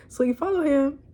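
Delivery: ordinary talk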